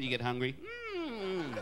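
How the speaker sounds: ordinary delivery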